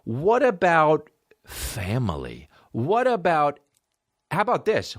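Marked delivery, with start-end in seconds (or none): none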